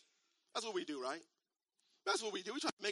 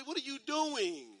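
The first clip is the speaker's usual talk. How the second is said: mock offended; 4 semitones higher, slower than usual. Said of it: "I'll be a little bit offended".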